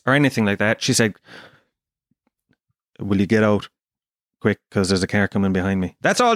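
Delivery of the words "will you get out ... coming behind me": low, deadpan order to get out; curt taxi driver. Retold or imitated. Taxi driver hurrying passenger out, curt and low. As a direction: imitate a brusque, unfriendly driver.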